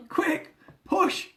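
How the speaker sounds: high urgent shout. Retold or imitated. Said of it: "I shouted".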